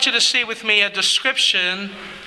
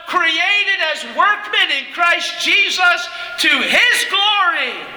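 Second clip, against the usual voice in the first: fervent preaching; 8 semitones higher than usual. Acted. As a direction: preach it with fire. Preacher rising in fervor.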